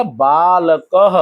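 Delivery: बालकः very slowly, "baa-la-ka-ha"; drawn-out visarga. Shown as a demonstration. Teacher modelling बालकः for the pupil, drawn-out visarga. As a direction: stretch बालकः syllable by syllable to a final "ha". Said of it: "इसका उच्चारण कैसे करेंगे".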